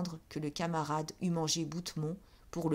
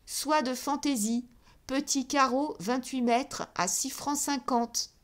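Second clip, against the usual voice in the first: higher and louder, calling out each item; ringing, triumphant; 7 semitones higher, 4 dB louder than usual. A narrator's reading. Clerk calling out stock in a ringing voice, triumphant. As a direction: announce it with a note of triumph, slowing at the end. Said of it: "répétait plus haut, d'une voix sonore où perçait le triomphe".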